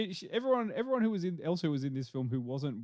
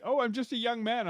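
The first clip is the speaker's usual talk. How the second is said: lightly mocked young man, pitched up; Nicholas Hoult; 10 semitones higher than usual. Podcaster mocking an actor playing young, lightly acted high voice. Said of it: "Nicholas Hoult going like".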